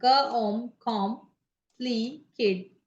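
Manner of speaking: "क", "ओम" joined as "कॉम", then "प्ली", "केट" separately; syllable by syllable. Shown as a demonstration. Teacher showing how to find syllables, "कॉम", "प्ली", "केट" said syllable by syllable. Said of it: "आपको syllable निकालना है".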